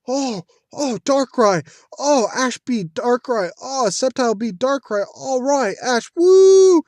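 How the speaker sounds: high, loud cheering, building to a whoop; hayali coşkulu tezahürat. Pretend. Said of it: "I would have thought".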